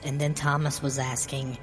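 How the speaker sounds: usual speaking voice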